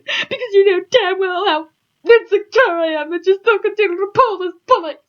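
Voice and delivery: high, loud, upset character voice; Iggy impression. Hurt lover accusing Alfred of ignoring him, high tearful rant. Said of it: "That's my Iggy impression".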